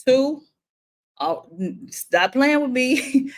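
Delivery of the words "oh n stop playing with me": slow, higher warning "stop playing with me"; half-playful threat to a pest; mock warning. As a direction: count them off, losing patience. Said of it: "It's like".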